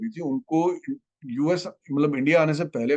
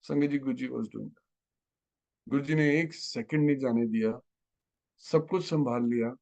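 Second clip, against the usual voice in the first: ordinary talk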